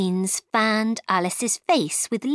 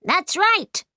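high, pleased exclamation; crying out; 11 semitones higher, 3 dB louder than usual. A narrator's reading. Red Queen agrees with Alice, crying out high. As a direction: cry it out in a much higher voice. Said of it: "cried the Red Queen".

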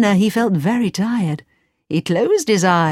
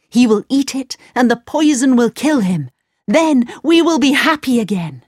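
higher, louder hopeful voice; Aladdin voice; 7 semitones higher, 4 dB louder than usual. A narrator's reading. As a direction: lift it with hope.

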